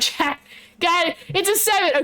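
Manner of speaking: usual speaking voice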